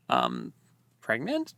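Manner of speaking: low um then a leap up on pregnant; surprised. Retold or imitated. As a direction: gasp out the question. Shocked realization about plot.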